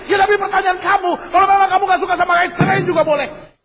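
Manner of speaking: high, shouted rapid-fire tirade; hammed-up husband losing his temper; furious husband. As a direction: explode in mock marital rage. Husband explodes in acted quarrel.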